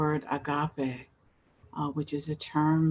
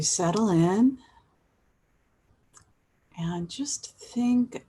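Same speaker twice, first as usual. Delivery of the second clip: guided meditation. Acted. Host inviting listeners to settle in, unhurried guided meditation. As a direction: ease them into gratitude, leaving space between phrases. Leads an opening gratitude meditation.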